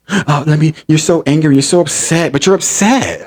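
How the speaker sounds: high, whiny mimicry of an accuser; mock-concerned, high put-on voice; mocking imitation. Mimicking Nick's accusations of anger.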